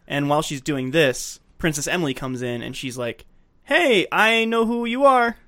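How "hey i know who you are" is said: higher, drawn-out teasing "I know"; slow sing-out of having caught someone; knowing callout. Retold or imitated. Acting the princess catching her out.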